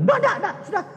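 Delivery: high, fast, fed-up no; annoyed sister cutting him off fast; irritated refusal. Retold or imitated. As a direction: brush him off quickly. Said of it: "Jengkel, dia marah".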